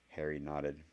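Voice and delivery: ordinary delivery, nothing marked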